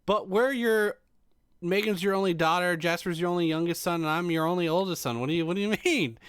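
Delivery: high, loud mock objection; baffled protest. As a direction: protest like a confused kid missing mom's joke. Kids protest mom's joke, acted.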